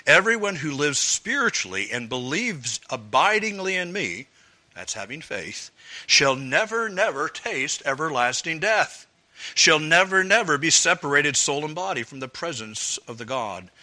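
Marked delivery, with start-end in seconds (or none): 0.1-4.3 louder, each word pressed; emphatic scripture reading. Shown as a demonstration. Preacher rereading the verse so the church hears it. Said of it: "Listen to it again". 6.1-13.7 never punched louder each time; emphatic repeated never. Shown as a demonstration. Preacher rereading the promise, stressing never. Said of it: "Listen to it again".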